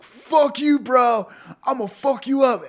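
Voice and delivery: high, loud angry shouting; acted belligerent outburst; aggressive bro. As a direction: yell it like a bro starting a bar fight. Acting aggressive bro in bar.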